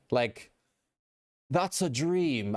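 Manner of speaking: slow, badly faked British accent. Streamer retries the comment to prove they can't do a British accent. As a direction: stretch it out in an unconvincing British accent. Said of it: "I'm terrible at accents".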